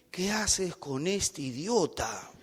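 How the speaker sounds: confiding aside. Retold or imitated. Preacher hugging the girl in solidarity, slow quiet aside. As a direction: drop it quietly and slowly, as a retold confidence.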